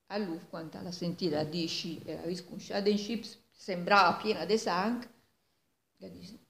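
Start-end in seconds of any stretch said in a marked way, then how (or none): none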